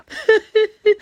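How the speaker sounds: a loud high laugh at her own joke; high burst of laughter. Real, not acted. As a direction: cackle up high.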